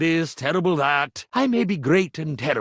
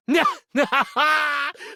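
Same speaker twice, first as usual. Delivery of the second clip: theatrical laugh; 8 semitones higher than usual. Acted. Laughing again at a joke.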